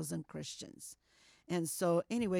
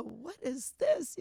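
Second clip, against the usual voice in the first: high, slow, a bit louder alarm; shocked question; 7 semitones higher, 3 dB louder, slower than usual. Retold or imitated. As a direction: gasp it out slowly in disbelief. Shocked at Bibles in suitcase.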